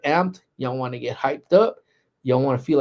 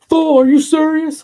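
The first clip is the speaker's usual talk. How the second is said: much higher, louder shout of disbelief from the next office; mock outraged yell; 10 dB louder than usual. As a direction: yell it across the office at a prank. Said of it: "So you hear him".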